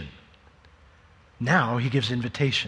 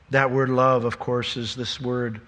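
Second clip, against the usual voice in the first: normal talking voice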